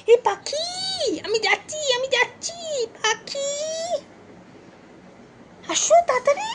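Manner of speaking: very high, eager squeaky calls. Real, not acted. Calling her pet to come see a bird, very high excited baby talk. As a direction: lure the pet over, bubbling with excitement.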